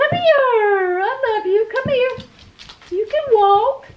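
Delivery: very high cooing pet voice. Real, not acted. Owner urging the dog to walk, very high baby talk.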